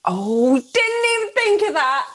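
high, drawn-out dismay; exaggerated high-pitched oh-no; mock horrified realization. Real, not acted. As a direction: wail it as a sudden realization. Mock dismay at smell suggestion.

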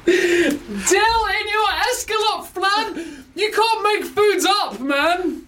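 high, drawn-out squeal through laughter; helpless, very high-pitched disbelief; incredulous outburst. Real, not acted. Cohost protesting an absurd food pun, incredulous.

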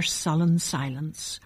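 usual speaking voice

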